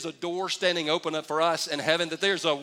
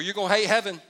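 usual speaking voice

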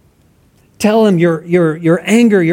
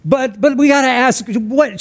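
normal talking voice

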